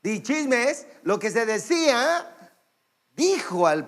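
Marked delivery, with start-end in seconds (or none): none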